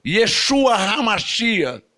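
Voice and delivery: "Yeshua HaMashiach" said slowly in Hebrew; "Yeshua HaMashiach" spelled out with care; Hebrew phrase. Shown as a demonstration. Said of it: "Isso é hebraico".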